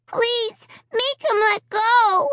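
put-on high, slowed plea of a little girl; a toddler begging through tears; wailing child. A narrator's reading. Little Alicia begging for help, wailing. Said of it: "Alicia wailed".

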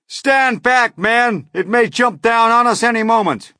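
high, loud, urgent yell; yelled warning. A narrator's reading. Police chief warning his men back, urgent yell. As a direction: yell them back from danger. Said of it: "yelled the chief of police".

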